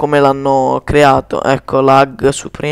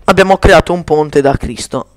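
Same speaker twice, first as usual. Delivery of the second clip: ordinary talk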